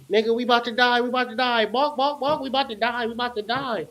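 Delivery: mock-panicky chicken chatter about dying; panicked chickens. Host imitates chickens in the coop, a touch of panic. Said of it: "intense yapping".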